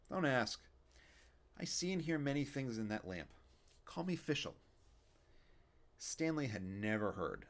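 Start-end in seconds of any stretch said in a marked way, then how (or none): 0.1-4.6 put-on a kvetching old man, raised up; Yiddish old man. Fischel voice continues.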